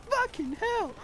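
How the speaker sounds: very high-pitched mock outburst; imitated server owner. Gamer mocking the hypocrite owner who dies, imitated high outburst. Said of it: "he's like".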